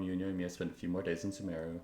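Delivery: normal talking voice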